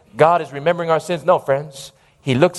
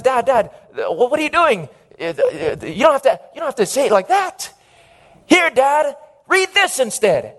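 embarrassed son; 7 semitones higher than usual. Acted. Embarrassed son scolds his father.

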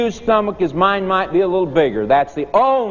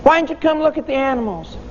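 put-on higher, quicker children's question; puzzled kids asking; 9 semitones higher than usual. Kids asking the teacher why he stayed seated, higher and puzzled. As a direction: ask it like bewildered kids. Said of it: "and we said to him".

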